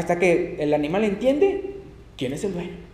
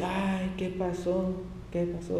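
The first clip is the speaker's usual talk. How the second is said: much softer, slow, dazed and lost; bewildered murmur; 2 semitones lower, 10 dB quieter, slower than usual. Retold or imitated. Preacher imagines blinded Saul asking himself, dazed soft murmur. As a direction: murmur it to yourself in shock. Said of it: "yo me imagino él preguntándose".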